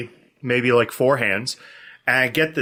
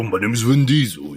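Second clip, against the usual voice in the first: action-star impression slipping into Arnold; low, put-on tough-guy voice; badly done movie-star imitation; Vin Diesel; 5 semitones lower than usual. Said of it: "that's Arnold".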